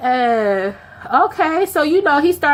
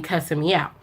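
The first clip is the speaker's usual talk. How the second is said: normal talking voice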